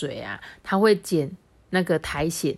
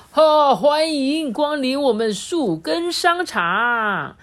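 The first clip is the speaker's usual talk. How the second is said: slow, high shopkeeper welcome; hammed-up grandpa greeting; welcoming grandpa; 7 semitones higher, 4 dB louder, slower than usual. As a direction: greet guests like a proud old shop owner. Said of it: "爷爷说".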